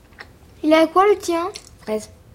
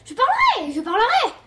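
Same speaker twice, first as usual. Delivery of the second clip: high put-on voice; 9 semitones higher than usual. Child acting a role.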